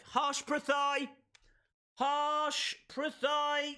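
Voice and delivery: winner announcement. Real, not acted. Streamer announcing the chat winner, slow and high.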